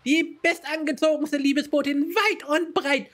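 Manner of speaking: a chirpy fashion-proud messenger introducing herself; high, bouncy show-off voice; bubbly Astrid voice. Acted. Reads Astrid's lines in character.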